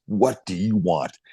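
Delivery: slow, drawn-out mock tough-guy threat; menacing tough guy. Gillies eyeballs the rookie after a punch, slow and menacing. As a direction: play the hulking enforcer, slow and menacing. Gillies turns, glares at him.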